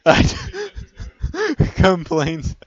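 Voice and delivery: high laughing words. Real, not acted. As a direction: laugh through it. Laughing fit.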